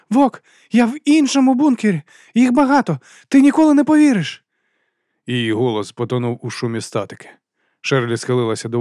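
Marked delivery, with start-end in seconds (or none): 0.1-4.4 very high, thin, louder voice; thin, distant. A narrator's reading. Juliette tells Walker of another bunker, thin and distant. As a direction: call thinly from far away, pitched way up. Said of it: "Голос став тонким і далеким".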